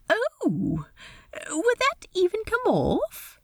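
high, lilting, polite voice; awkward host in a high register; Steed, politely awkward. Acted. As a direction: pitch it high, hesitate politely. Steed's dialogue voice.

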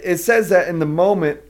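ordinary talk

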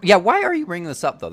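fast, loud and high challenge; excited. Real, not acted. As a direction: fire the question off eagerly.

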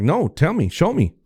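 high-pitched retold challenge; re-enacted pushy insistence; insistent demand. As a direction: demand proof, pitch jumping up. Retold confrontation, demanding proof.

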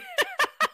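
quick high-pitched giggle. Real, not acted. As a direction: burst into a short giggle. Laughs at own joke.

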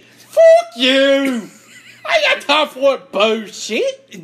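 mock angry shout. Friend game banter, fake furious yell. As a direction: bark it out in fake anger.

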